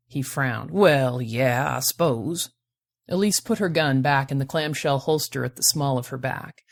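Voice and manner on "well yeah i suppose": put-on lower, grudging lilting accent; reluctant accented prospector. A narrator's reading. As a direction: concede it with a frown, in the accent. Prospector reluctantly concedes, with a frown.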